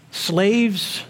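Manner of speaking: ordinary talk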